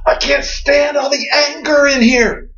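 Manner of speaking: high, slow, hammed-up shout; cartoon outburst. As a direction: explode like a cartoon character. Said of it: "tears his hair like a cartoon character and says".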